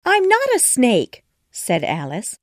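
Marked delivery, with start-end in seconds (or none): 0.0-1.2 high, loud, stress on not; indignant, insisting on not; upset denial. A narrator's reading. Alice protesting to the pigeon, high and loud. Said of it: "upset at being called".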